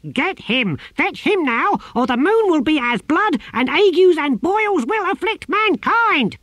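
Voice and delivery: the tortoise god commanding the novice; raging tortoise god. A narrator's reading. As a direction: thunder like an angry god.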